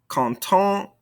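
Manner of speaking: "Content" left open on the nasal vowel; silent ending consonants. Shown as a demonstration. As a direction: leave the last T of "Content" silent. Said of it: "these ending consonants".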